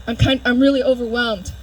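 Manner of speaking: ordinary delivery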